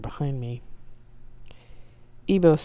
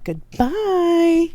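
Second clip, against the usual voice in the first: huffy goodbye; 7 dB louder than usual. A narrator's reading. Ibo flounces off.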